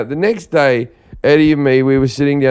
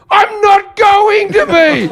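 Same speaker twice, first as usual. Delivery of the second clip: laughing shout; slower than usual. Retold or imitated. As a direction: bellow it while cracking up.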